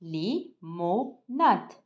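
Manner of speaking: li-mo-nade split into slow separate syllables; teacher sounding out limonade for class. Shown as a demonstration. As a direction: spell limonade out beat by beat, very slow. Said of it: "syllabe par syllabe avec moi".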